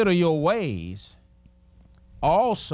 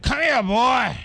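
high, louder, leering call from behind bars; creepy caller; 6 semitones higher, 4 dB louder, slower than usual. Retold or imitated. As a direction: lure the scared boy in. Man behind bars calls the child.

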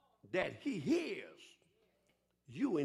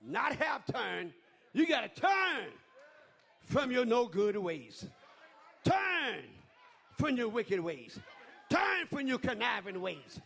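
higher, rising shouts of a preacher; full-force preaching; 5 semitones higher, slower than usual. Acted. Preacher rallying the congregation in a ringing call. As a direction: whip the church up, call and build.

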